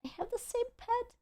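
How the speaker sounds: high, hushed gasp of recognition; surprised. Real, not acted. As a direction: squeak it out in surprise. Gasps at matching pet.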